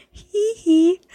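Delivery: high, squeaky [name] giggle; imitating [name]; [name] hee hee. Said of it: "sent a second message that just said".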